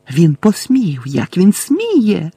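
incoherent shrieking. Acted. Bellatrix shrieks incoherently about Harry, high and slow. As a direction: lose control with outrage. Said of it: "незв'язно верещала Белатриса".

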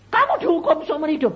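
high, loud, quick question; retold shocked exclamation; acted astonishment. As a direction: burst out in disbelief, voice jumping up.

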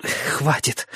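breathless whisper; gasping. Acted. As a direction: gasp it out, no voice. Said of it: "хватая ртом воздух".

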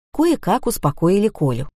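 plain reading voice

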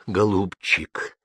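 slow, drawn-out tender address; fatherly tenderness. A narrator's reading. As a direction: soothe him gently, lingering on the word. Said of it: "промолвил он с нежностью".

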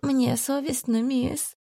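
high, soft, slow weeping; ashamed and crying; tearful. A narrator's reading. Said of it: "Жена курьера расплакалась".

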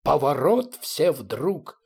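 slow, drawn-out naval command; measured commanding call; commander's order. A narrator's reading. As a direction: call the manoeuvre out to the fleet. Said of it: "командовал".

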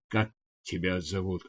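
quiet, nervous, voice shaking; trembling voice. A narrator's reading. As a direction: ask shyly, trembling with nerves. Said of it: "Голос Вели дрожал". (тихо, дрожащим от волнения голосом)

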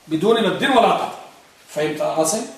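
usual speaking voice

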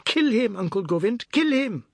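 high-pitched bloodthirsty urging; excited shrieked chant; shouting. A narrator's reading. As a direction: shout it high, egging him on. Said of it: "kept on shouting".

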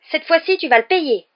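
furious high, loud threat; yelled. A narrator's reading. Ron turning his wand on Malfoy, yelling. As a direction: shout it as a threat. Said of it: "hurla-t-il".

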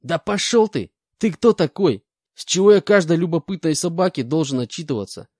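high, loud indignant convict; defiant rant. Acted. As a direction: bristle and refuse to answer. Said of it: "продолжал ерепениться бес".

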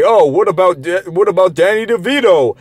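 put-on arguer's voice, lifted in pitch; mocking objection. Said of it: "they'll like to say".

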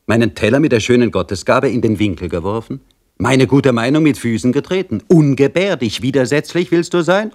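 sneering jailer, sharp and taunting; scolding jailer. Acted. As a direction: rant at the prisoner with scornful glee.

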